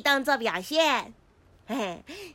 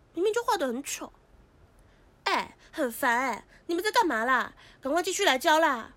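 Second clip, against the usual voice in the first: ordinary delivery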